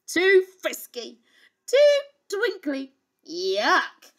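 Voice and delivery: fussy, high princess complaint; loud picky exclamation; crying out, disgusted. Acted. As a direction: reject each one like a spoilt child. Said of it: "she cried".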